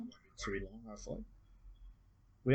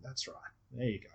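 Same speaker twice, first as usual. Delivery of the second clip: soft, gentle reassurance; 8 dB quieter than usual. Shown as a demonstration. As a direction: murmur it gently to the client. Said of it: "we'll do this very gently".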